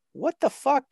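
a squeaky disbelieving what the fuck; exasperated inner outburst. Retold or imitated. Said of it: "I'm thinking to myself".

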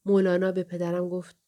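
ordinary delivery, nothing marked

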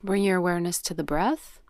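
unhurried yoga nidra guidance with long pauses; guided meditation. Real, not acted. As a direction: guide them gently into breath awareness, leaving space after each cue.

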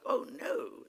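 high, mock-indignant refusal; sassy head-wagging refusal. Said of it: "They got that head still going on".

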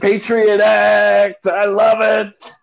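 Alec Baldwin quote. Acted. Film fan quoting an actor's over-the-top line, hammed-up crowing. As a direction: belt it out slowly, hammed-up and relishing. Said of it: "he walks up to the guy and he goes".

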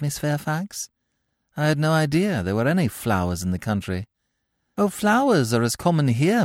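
high, sugary barbs; catty politeness. Acted. The two girls trading barbed compliments. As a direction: smile while you sting.

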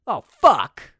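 short 'oh', loud punched curse; mock cursing. Bad actor retrying Mel's tone, loud cursing. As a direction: hit the curse hard, badly acted. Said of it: "It's so hard to convey tone".

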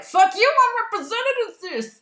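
high, loud, furious yell; defiant mock outburst. Later [name] hitting back at her image, defiant mock yell. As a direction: explode in hammed-up defiance.

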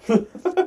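short high laugh; cracking up; real laugh, high. Real, not acted. Laughing at story.

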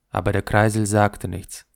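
usual speaking voice

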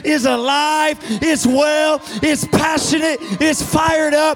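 loud, high, slow preaching; preaching at full force. Acted. Pastor firing up the congregation, full-force preaching. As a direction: build the sermon to a roar.

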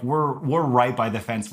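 ordinary delivery

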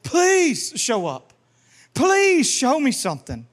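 high, drawn-out cry on each Please; desperate begging. Shown as a demonstration. Preacher calling on the name of the Lord, pleading hard. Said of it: "I'm just calling on the name of the Lord".

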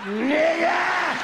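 high, loud outburst; exasperated shout. Retold or imitated. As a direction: throw it up in disbelief. Exasperated cry at girlfriend.

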